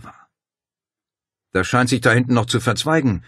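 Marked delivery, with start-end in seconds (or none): 1.5-3.2 leicht erhobene, staunende Feststellung; staunend. A narrator's reading. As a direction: mit mildem Erstaunen sprechen. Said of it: "stellte Paul staunend fest". (leicht staunend)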